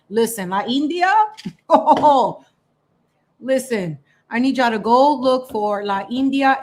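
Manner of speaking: usual speaking voice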